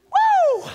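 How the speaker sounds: high, sharp "woo" of release; whoop. Real, not acted. Preacher recovering from his outburst, whooping. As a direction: let off steam with a high whoop. Lets off steam after hollering.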